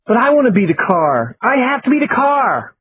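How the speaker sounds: put-on high, rising whine of a five-year-old; whining child. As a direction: plead like a spoiled little kid. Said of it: "whined across the Monopoly board".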